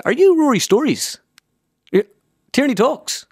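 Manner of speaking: very high, loud impersonated questioning; Joe Brolly. Acted. Pundit guessing who the caller is, high impersonation. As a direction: shoot the pitch far up as the pundit. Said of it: "And he says".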